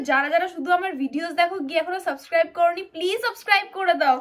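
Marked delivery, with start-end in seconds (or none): none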